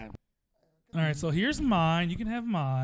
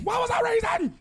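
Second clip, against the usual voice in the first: shouted, much higher [name] squeal; [name] impression; 3 dB louder than usual. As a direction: blast it out like [name]. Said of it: "She just went [name] on me".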